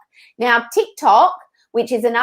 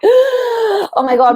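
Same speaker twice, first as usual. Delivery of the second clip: high, loud, drawn-out "oh my God" of panic; mock panic, pitched way up; acted overwhelm; 12 semitones higher, 5 dB louder, much slower than usual. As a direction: wail it slowly as if overwhelmed. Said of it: "that overwhelm and that fatigue and that feeling".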